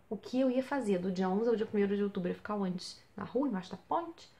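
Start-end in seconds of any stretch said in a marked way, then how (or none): none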